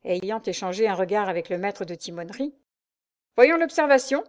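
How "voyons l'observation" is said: louder terse command; curt dry order. A narrator's reading. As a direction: cut him off coldly. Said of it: "dit-il d'une voix sèche". (voix sèche, plus forte)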